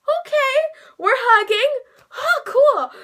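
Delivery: high, loud retold starstruck squeak; flustered delight. Fan suddenly hugged by her idol, flustered delight. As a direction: act out being thrilled and thrown, breaking toward laughter. Flustered reaction to surprise hug.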